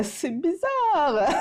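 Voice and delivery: high, long-drawn mock squeal; comic weirded-out reaction. Vlogger mimicking shoppers facing odd discount finds, comic weirded-out squeal. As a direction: stretch it out high, breaking into a laugh.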